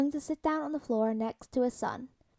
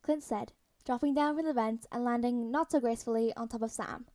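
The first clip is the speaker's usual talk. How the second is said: ordinary delivery, nothing marked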